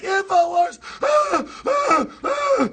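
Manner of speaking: high shrieking imitation of her; mock shriek. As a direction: shriek it like a hysterical protester. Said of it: "She's the one that was like".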